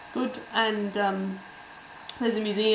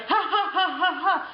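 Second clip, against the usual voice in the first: imitating a high fake laugh; a hammed-up chuckle, pitched much higher; mock laughter; 9 semitones higher than usual. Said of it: "they're like".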